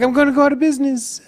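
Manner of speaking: high, fast whiny voice; mock panicked voice. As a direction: squeal it out in a panic. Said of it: "Be like".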